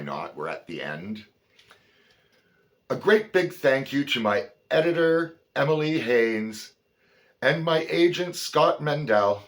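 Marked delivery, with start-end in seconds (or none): 2.9-9.4 loud, high, presentational read; big projected reading voice; acknowledgements read aloud. Acted. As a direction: announce your thanks to an audience.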